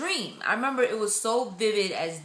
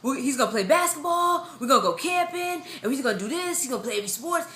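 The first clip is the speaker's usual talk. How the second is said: imitating a thrilled dad-to-be, pitched up; husband going crazy; 5 semitones higher than usual. Wife imitating husband at news of a boy, going crazy with excitement. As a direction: gush out the plans in a raised voice, as him. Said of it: "my husband was going crazy".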